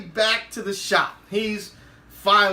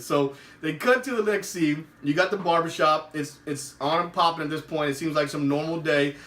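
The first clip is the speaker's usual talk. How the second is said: usual speaking voice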